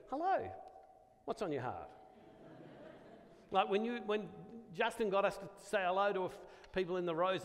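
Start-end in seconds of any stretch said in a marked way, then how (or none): 0.1-1.9 mock greeting. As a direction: send up the odd opener with a sing-song hello. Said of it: "You go".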